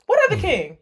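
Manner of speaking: high-pitched, incredulous retort; mock indignant disbelief. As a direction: act out his affronted disbelief, pitch jumping up. Acting out character's indignant reaction.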